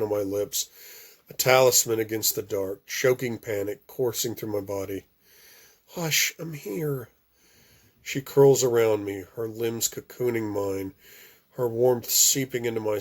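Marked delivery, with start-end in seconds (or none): 5.9-7.1 slow, soft reassurance; soothing hush. Acted. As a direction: hush him gently. Comforting after nightmare.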